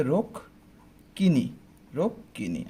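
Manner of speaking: "রুক কিনি" split as "ruk... kini"; doubled k, split syllables. Shown as a demonstration. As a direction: break "রুক কিনি" after the first k. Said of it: "কীরকম উচ্চারণ করব?". ("রুক কিনি" ভেঙে দুটো ক স্পষ্ট করে)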